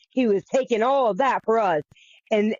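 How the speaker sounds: ordinary delivery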